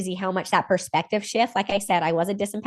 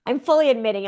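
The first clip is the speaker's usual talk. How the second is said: normal talking voice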